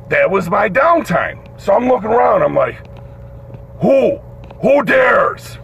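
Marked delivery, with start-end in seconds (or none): 3.8-5.6 raised-pitch, indignant demand; acted-out incredulous anger; mock outrage. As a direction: challenge the room like an affronted boss. Outraged guard looking for culprit.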